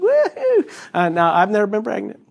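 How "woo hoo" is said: excited whoop. Retold or imitated. Baby doing somersaults.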